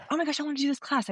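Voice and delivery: a rush of high, fast delight; excited. Retold or imitated. Telling friend her instant thrill, high and fast. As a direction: gush it out like fireworks going off.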